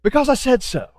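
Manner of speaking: a firmer second take of the dad line; dad voice again. Retold or imitated. A pastor repeating his dad's tired reply to the child. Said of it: "I sound just like my dad".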